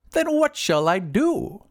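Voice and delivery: high, slow wizard's question. A narrator's reading. Wizard asks the glassblower for advice, high slow voice. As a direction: ask high and drawn out, at a loss. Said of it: "asked the wizard".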